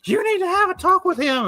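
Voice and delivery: high-pitched mock angry mom; pissed-off mom. Mom fed up with dad over the pictures, pissed off. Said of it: "his mom was so pissed off".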